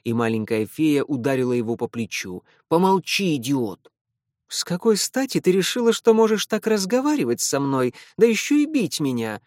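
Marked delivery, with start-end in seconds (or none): 2.7-3.9 angry. A narrator's reading. Fairy snaps at teasing Leo. 4.5-9.4 high, loud outraged protest; indignant. A narrator's reading. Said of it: "возмутился Лео".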